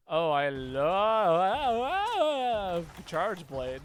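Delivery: slow sarcastic "Oh, I love"; drawn-out mock enthusiasm for "love". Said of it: "I say it very sarcastically".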